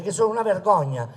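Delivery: ordinary talk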